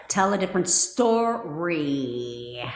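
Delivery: "story" stretched long and slow; drawn-out refrain. Shown as a demonstration. Coach prompting viewers to change their thinking, drawn-out refrain. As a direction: slowly draw out "story", letting it sink. Chants the advice, drawing it out.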